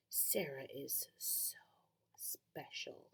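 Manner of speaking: slow, soft hissed whisper; jealous whisper. Acted. Jealous girl hissing into Sarah's ear, whispered. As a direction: hiss it right into her ear, spiteful. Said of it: "Annie whispered, hissed in my ear".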